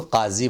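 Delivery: "काजी" as qaazi; careful Urdu z in "काजी"; correct pronunciation. Shown as a demonstration. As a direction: say "काजी" with throaty q and z. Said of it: "इसको काजी बोलें".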